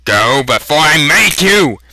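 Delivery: loud, far-off threatening voice. Acted. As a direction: threaten him outright. Knife-waving threat.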